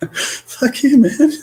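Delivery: a very high squeal of a curse through laughter; laughing fuck you. Retold or imitated. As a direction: curse again, cracking up. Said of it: "just like".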